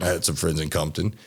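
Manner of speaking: ordinary delivery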